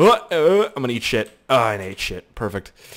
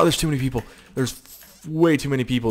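ordinary talk

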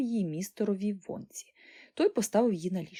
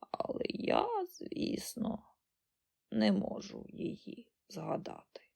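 very slow, hushed reminiscing; frail old granny; 2 semitones lower, 6 dB quieter, slower than usual. A narrator's reading. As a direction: drag the memory out faintly, with little voice. Ancient granny struggles to remember.

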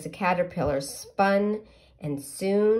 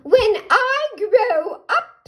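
much higher, slow caterpillar singsong; 10 dB louder, slower than usual. Acted. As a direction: draw it out high and proud. Caterpillar tells again.

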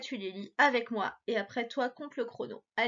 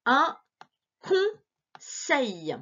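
slow reading; 3 semitones higher, much slower than usual. Shown as a demonstration. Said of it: "essaie de lire avec moi".

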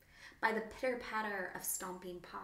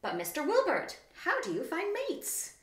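high, loud, surprised squeak; surprised little wallaby; 11 semitones higher, 5 dB louder than usual. Acted. Wendy, surprised, asks the wombat about mates. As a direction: rise up in puzzled wonder. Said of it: "she quickly asked".

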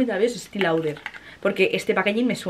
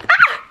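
sudden high squeal of delight, much higher and louder; excited shriek; 7 dB louder than usual. Real, not acted. Unboxing a surprise package, she lets out a high delighted squeal. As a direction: shriek at the discovery.

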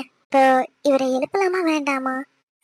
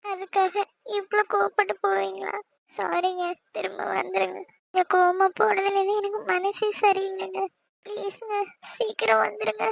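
softer, higher pleading sorry; pleading apology; 4 semitones higher, 4 dB quieter than usual. Acted. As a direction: beg him gently to come back. Wife pleads after husband leaves angry. (மென்மையாக கெஞ்சி மன்னிப்பு கேட்பது)

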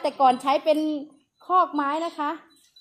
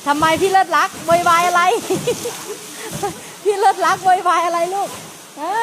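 playful scolding; 5 semitones higher than usual. Real, not acted. Playfully scolding noisy baby elephant.

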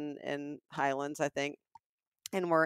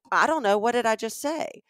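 higher, louder retold retort; defensive; 5 semitones higher, 5 dB louder than usual. Saleswoman's customer challenged over her words, defensive. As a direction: imitate someone getting defensive, throwing the question back. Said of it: "she's already on the defensive".

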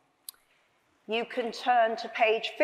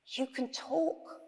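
slow, soft, higher-pitched amazement; whispered; 4 semitones higher, 7 dB quieter, slower than usual. A narrator's reading. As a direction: murmur it slowly in hushed disbelief. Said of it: "whispered Jack".

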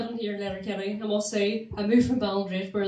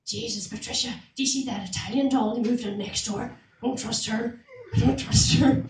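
gossiping neighbour. Retold or imitated. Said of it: "The neighbours would be at their wee net curtains going".

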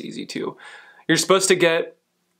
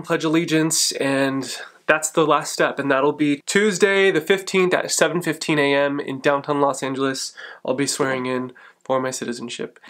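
ordinary talk, nothing marked

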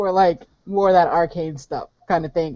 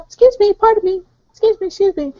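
high, louder little pardons; high polite excuses; 4 dB louder, faster than usual. Acted. Prisoner squeezing back through to Raven, high polite excuses. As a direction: pipe up high to get through.

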